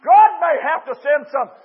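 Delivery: high, loud, driving sermon delivery; full-force preaching. Real, not acted. Preacher warns those headed in the wrong direction, full-force preaching. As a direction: preach it hard to the congregation.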